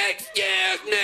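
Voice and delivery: grotesque high-pitched mimicry; mocking the lady. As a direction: jeer her words back in a squeal. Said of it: "mock her and be like".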